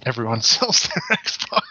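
laughing it out slowly; a laugh breaking through the joke. Real, not acted. Joking about Xbox owners selling.

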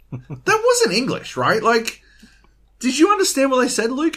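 high, disbelieving plea; incredulous disbelief. Retold or imitated. Gamer asking Luke about garbled teammate, incredulous and high. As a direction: ask in high disbelief, hurried. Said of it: "And I'm like".

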